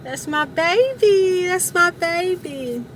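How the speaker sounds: put-on slow, high coo to her baby dog; cooing baby talk.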